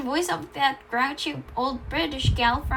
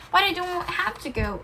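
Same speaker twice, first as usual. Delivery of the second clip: higher, mildly embarrassed protest; a touch of embarrassment, pitched up; a little embarrassed; 7 semitones higher than usual. A narrator's reading. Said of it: "a little embarrassed".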